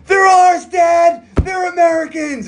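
high, loud joyful shout; excited shout. Retold or imitated. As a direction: yell it up high with relief. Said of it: "He goes".

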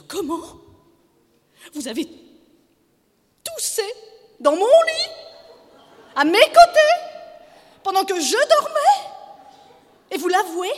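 very high, outraged wife; shrieked indignant questions; fresh anger. A narrator's reading. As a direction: fire it off in high, broken outbursts. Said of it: "un sursaut de colère nouvelle".

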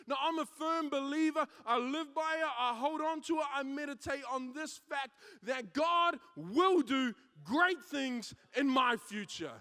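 loud, driving sermon climax; preaching at full force. Acted.

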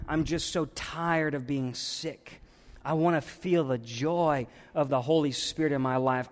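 soft, low pleading prayer; desperate prayer. Acted. Worshipper at wit's end, praying softly to God. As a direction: pray it quietly, at your wit's end.

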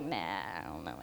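low, mumbled shrug; sheepish backing out. Retold or imitated. Student joking about changing her mind, in a low mumble. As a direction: let it drop with a sheepish mumble.

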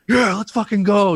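high, loud pumped-up shout; hype yell. Retold or imitated. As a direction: fire up the team with a yell. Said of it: "they'd be like".

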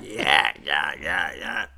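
very low mumbled imitation; sick friend answering. Drunk friend answering through the restroom door, low and dismissive.

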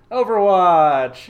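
drawn out, loud announcement; announcer voice. Acted. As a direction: announce it like a game show host. Podcast host announcing topic.

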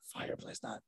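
whispered echo of the odd wording; puzzled aside. Real, not acted. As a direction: whisper it to yourself, baffled. Repeats the odd phrase to himself.

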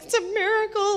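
high, bright burst of joy; overjoyed at a friend's conversion; elated. Retold or imitated. Retelling her joy after leading a friend to Jesus, elated. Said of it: "I was elated".

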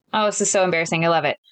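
ordinary delivery

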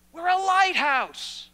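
slow, loud declaration; emphatic proclamation. Acted. Preacher declaring to the church, slow and loud. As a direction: boom it out as a proclamation.

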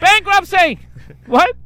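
high, loud grand call; loud announce. Pretend. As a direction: declare it grandly. Mock Monopoly call.

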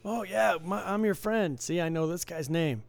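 mock the guy claiming friendship, pitch up; overfamiliar buddy. Regular claiming the dealer as his friend, mock-chummy. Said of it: "There's a guy that's been coming in lately".

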